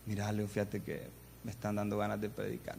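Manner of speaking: low, soft, hesitant admission; acting out a timid confession; sheepish confession. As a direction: confide it quietly to a cousin. Sheepish confession to cousin, retold.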